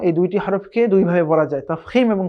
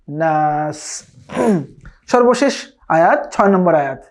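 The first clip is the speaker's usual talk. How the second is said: usual speaking voice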